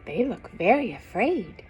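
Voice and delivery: plain reading voice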